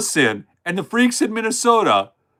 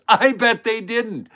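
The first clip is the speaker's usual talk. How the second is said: higher, slow mocking jab; sarcastic emphasis; 5 semitones higher, slower than usual. Shown as a demonstration. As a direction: lean into the sarcasm, pitched up.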